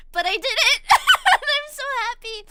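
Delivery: laughing with joy. Real, not acted. Overjoyed about merch launch.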